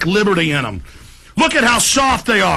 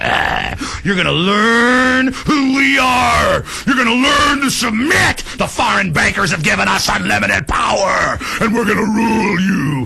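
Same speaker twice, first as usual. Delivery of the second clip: mock thug cop voice; slower than usual. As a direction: bark it like a brutal cop. Imitating cops threatening protesters.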